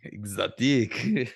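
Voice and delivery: mock French accent, slow and drawn out. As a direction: purr it like a hammed-up Frenchman. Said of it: "I went with a French accent there".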